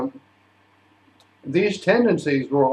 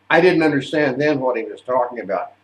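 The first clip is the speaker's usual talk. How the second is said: ordinary talk, nothing marked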